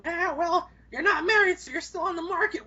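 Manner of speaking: put-on high laughing old-lady voice; flirty granny. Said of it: "This one granny's hitting on me".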